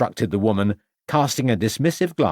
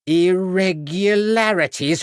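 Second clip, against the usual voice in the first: high, slow, outraged repeat; high slow outraged echo; indignant outrage; 10 semitones higher, 3 dB louder, slower than usual. A narrator's reading. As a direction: throw the word back, high and slow.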